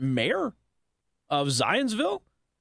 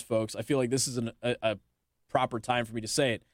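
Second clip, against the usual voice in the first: normal talking voice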